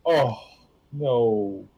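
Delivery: ordinary talk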